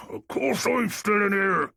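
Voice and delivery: muffled, boxed-in speech. Acted. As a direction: voice from behind a van door. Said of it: "came the muffled sound of another man's voice".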